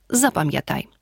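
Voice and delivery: normal talking voice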